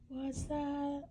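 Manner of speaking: high, soft frightened question; scared hush. Acted. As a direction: ask it timidly in fear. Scared hen, hushed.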